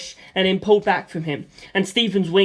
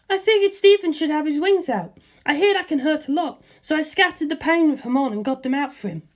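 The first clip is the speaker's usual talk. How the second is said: put-on higher breezy young woman's voice; Lisa Lisa; 9 semitones higher than usual. Lisa Lisa reassuring George about her skirt, higher girlish voice. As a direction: toss off reassurance lightly, in a higher girl's voice.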